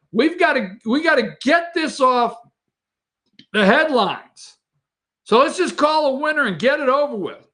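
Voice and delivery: high, hammed-up voice of panicked officials; whiny bureaucrat impression; mock election officials. Host mocking election officials in a high panicked voice.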